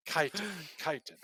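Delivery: chitin repeated as KY-tin, hard k; practicing a word. Shown as a demonstration. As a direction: chitin drilled twice, KAI-tin. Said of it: "K Y T I N".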